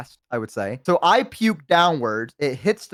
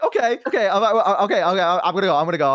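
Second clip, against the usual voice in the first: retold flustered retreat; 4 semitones higher, faster than usual. Flustered retreat from yelling manager.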